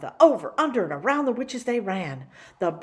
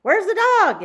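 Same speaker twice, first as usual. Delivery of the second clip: very high, loud yell; 14 semitones higher, 12 dB louder than usual. Acted. Witch yelling during the chase, high loud yell. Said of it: "yelled another".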